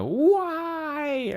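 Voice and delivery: long, high-pitched wail; mock howling. Ice King grieving his only friend, mock howling. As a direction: stretch it into an anguished mock howl. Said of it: "starts howling".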